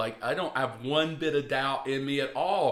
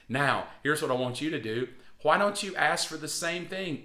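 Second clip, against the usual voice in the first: ordinary talk, nothing marked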